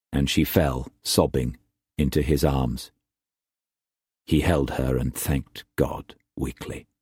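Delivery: ordinary delivery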